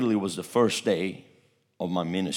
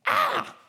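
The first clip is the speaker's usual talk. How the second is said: high-pitched imitated scream; a short, very high "Ah!", imitating her scream; retold scream. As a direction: mimic a sudden shriek. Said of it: "she screamed".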